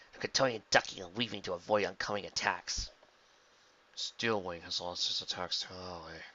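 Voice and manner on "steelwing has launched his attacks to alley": low, soft, slow villain mutter. A narrator's reading. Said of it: "he muttered".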